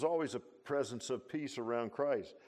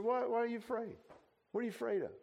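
higher, lightly acted questioning; gently voiced Jesus' question; 8 semitones higher, 4 dB quieter, faster than usual. Preacher as Jesus asking frightened disciples, high mild questioning.

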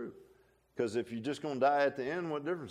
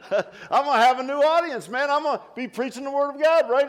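higher and much louder, laughing through the words; laughing excitedly; 11 semitones higher, 10 dB louder than usual. Real, not acted. Preacher joking to the congregation about a new heavenly audience, laughing loud. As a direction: bubble over with delight at his own joke.

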